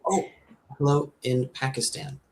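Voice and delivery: ordinary talk, nothing marked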